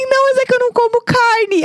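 high-pitched flustered self. Retold or imitated. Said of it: "Aí eu assim".

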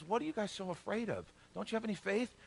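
soft, mildly puzzled questioning; just woken up. Acted. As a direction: ask quietly, as if roused from sleep. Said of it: "woke up from a dead sleep".

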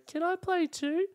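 put-on high, drawn-out small child voice; little kid. Kid asking to join a cricket game, little kid voice. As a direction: pipe up like a hopeful little kid. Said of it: "one little kid standing watching us".

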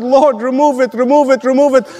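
mock pleading with each repeat pressed; imitated pleading. Acting out Paul's repeated prayer.

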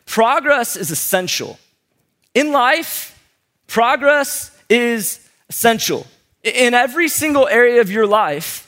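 slow, weighty sermon delivery; preaching. Acted. Preaching the point.

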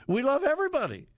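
high, fast, sing-song parody; mocking liberal mantra. Host mocking the liberal left's mantra in a sing-song. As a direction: chirp it like a smug slogan. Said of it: "the mantra of the liberal left".

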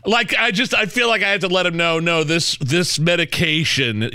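rising up in embarrassment; worked up. Real, not acted. Acting out his pharmacy embarrassment.

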